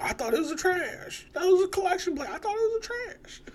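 squealed high and loud, near crying; high mock quote. Retelling the movie scene to a friend, high mock whine. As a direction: pitch it way up like a whining complaint.

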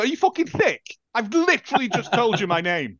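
high-pitched, incredulous retort; retold exasperation, pitched right up at first; indignant outburst. Acts out her indignant reply.

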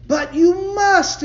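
very high, loud and very slow pleading; urgent insistence. A narrator's reading. Companion urging John while they run, desperate. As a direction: drag out the plea in panic. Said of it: "implied his companion".

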